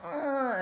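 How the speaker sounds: high strained grunt of someone tied up; mock struggling grunt. Imitating the hogtied managers squirming, a high strained grunt. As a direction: grunt like you're trying to wriggle free.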